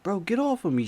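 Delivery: angry shove-off; firm demand. Retold or imitated. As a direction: cousin ordering him off. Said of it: "He like".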